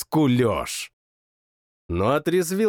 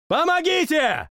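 higher urgent shout; shout for help; 14 semitones higher than usual. A narrator's reading. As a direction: yell for help bursting in. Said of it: "крикнул". (крик о помощи)

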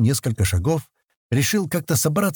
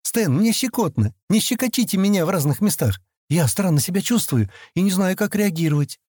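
earnest, higher-pitched character voice; mildly serious objection in a raised pitch; 8 semitones higher than usual. A narrator's reading. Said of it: "Келли серьёзно сказала".

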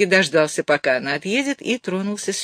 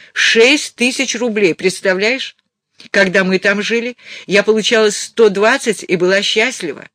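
louder, raised-pitch mother's voice; worked up and insistent; agitated mother; 4 semitones higher, 6 dB louder than usual. Acted. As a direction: tell him the scandalous news. Reader plays agitated mother.

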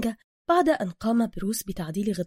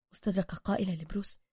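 ordinary delivery

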